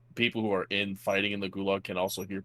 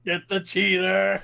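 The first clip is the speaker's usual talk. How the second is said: high, slow drawn-out rallying call; mock battle cry; 9 semitones higher, 3 dB louder, slower than usual. Lobby ganging up on a cheater, mock war cry. Acting mob hunting a cheater.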